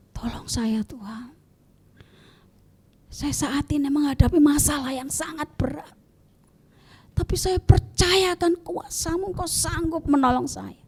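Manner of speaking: soft, quiet, sincere plea; humble prayer. Shown as a demonstration. Believer calling on God in a heavy struggle, hushed. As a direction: pray gently, trusting God.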